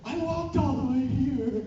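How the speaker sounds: high, slow, laughing put-on voice of the fan; proud fan voice. Fan at his door boasts in a slow high voice. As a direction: play the fan boasting of her long walk, slow and high. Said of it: "And she's like".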